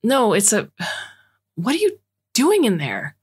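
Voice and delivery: high, startled stammer into a question; flustered. A narrator's reading. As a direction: break off, then demand an answer in shock. Son startled, confronting parents in hole.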